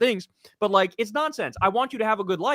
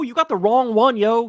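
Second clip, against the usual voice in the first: raised, pushing voice; louder defiance; 3 semitones higher, 5 dB louder than usual. Real, not acted. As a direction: throw it back at them hard.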